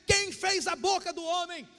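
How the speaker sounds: high-pitched, booming rebuke; furious God. Acted. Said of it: "Deus fica furioso com Moisés".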